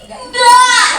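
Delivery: put-on very high, loud childish wail; imitated crying child. Lecturer plays scolded child answering mother, mock wailing. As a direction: wail it like a cornered toddler. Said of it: "anaknya nangis mau pipis".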